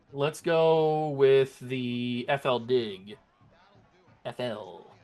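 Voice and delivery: usual speaking voice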